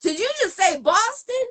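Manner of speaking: high, loud, amazed DJ cutting the music; surprised DJ. Retold or imitated. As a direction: call it out like a stunned DJ who stopped the track. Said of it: "He's like".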